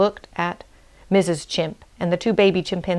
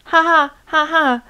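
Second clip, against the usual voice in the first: loud, high mocking ha-ha; high, forced cackle; hyena's laugh; 10 semitones higher, 5 dB louder than usual. A narrator's reading. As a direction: burst of put-on hyena laughter. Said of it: "the hyena laughed".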